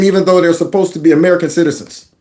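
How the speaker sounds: ordinary delivery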